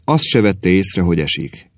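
usual speaking voice

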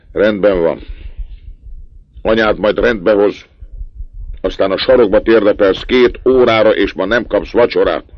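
father scolding his son loudly; stern punishment. A narrator's reading. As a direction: lay down the punishment hard.

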